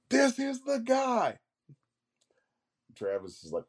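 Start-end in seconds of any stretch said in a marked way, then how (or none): 0.1-1.4 high, slow, drawn-out mock yell. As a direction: imitate shouting an announcement across a booth. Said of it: "they yell at each other".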